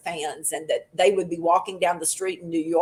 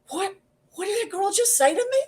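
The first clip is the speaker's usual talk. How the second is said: high, quick, incredulous question; pitched-up, hurried mock indignation; mock upset disbelief; 13 semitones higher, faster than usual. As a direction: imitate someone stung and baffled by an insult. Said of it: "he's all upset about it".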